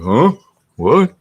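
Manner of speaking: high, loud startled huh; puppy's reaction. Retold or imitated. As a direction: bark out a dopey puppy double take. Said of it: "She's like".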